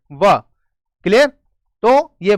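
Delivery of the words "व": व with teeth on lower lip, short and lower; v sound demo. Shown as a demonstration. Teacher showing students the sound, teeth on lower lip. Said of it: "दांत टकराएंगे नीचे होठ से".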